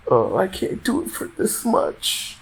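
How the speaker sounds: high breathy mock plea; breathy high mock whine. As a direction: whine it, fake helpless. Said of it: "and be like".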